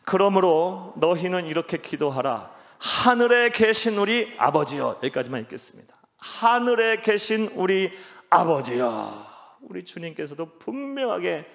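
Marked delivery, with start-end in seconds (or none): 0.0-5.0 loud, high unison-leading read; declaimed Bible verse; reading scripture together. Real, not acted. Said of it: "다시 함께 읽겠습니다". 6.2-9.3 loud, high, slow verse reading; scripture declamation. Real, not acted. Leads verse reading once more.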